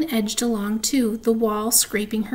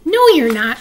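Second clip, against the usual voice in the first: loud scoff; 3 semitones higher, 9 dB louder than usual. Acted. Sister scoffs at fake ghost.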